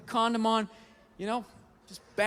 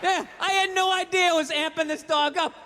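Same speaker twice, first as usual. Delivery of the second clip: usual speaking voice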